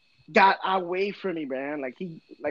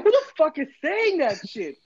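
a sudden startled outburst, pitched up; 9 semitones higher than usual.

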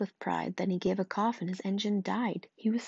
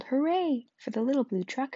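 cheering hooray; 5 semitones higher than usual. Acted. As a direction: cheer it for the little truck.

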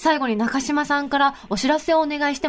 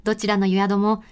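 sponsor ad read; 3 semitones lower than usual. Acted. Host reading a resort notice to listeners, measured ad read. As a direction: read the resort spot in unhurried phrases.

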